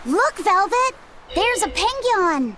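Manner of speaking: excited calling out. Acted. Traveller pointing out a penguin to a companion, excited. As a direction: call a companion to see your discovery.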